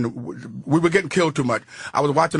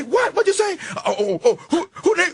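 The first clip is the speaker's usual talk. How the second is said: high mock shocked girlfriend voice; startled girlfriend asked about rent, pitched way up; girlfriend reacting; 12 semitones higher than usual. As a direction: squeal it up high like the startled girl.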